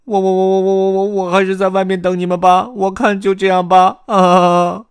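high, loud panicked stammer; 吓坏的贝利奥. A narrator's reading. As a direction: stutter fast, then wail in fright. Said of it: "贝利奥吓得脸色苍白". (吓得高声结巴、哀叫)

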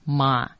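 妈 as an open "ma"; character read as "ma". Shown as a demonstration. Teacher showing how 魔 is read, pronunciation demo. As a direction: demonstrate 妈 as one open syllable. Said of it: "魔念成".

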